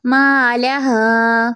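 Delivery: slow recited मा ला हा, vowels drawn; Quranic recitation. Shown as a demonstration. Teacher recites the verse slowly for students. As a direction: chant it slowly. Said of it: "अलिफ मद्दा का कायदा".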